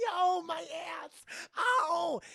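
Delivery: hammed-up pained scream; mock pained shriek. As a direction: squeal like you just got shot. Shot in the backside.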